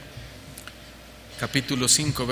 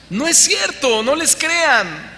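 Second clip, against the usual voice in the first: high, loud, slow denunciation; emphatic denial; 10 semitones higher, 3 dB louder, slower than usual. Real, not acted. Preacher to congregation, loudly denying false visions. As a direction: hammer home that it's a lie. Preacher denouncing, full force.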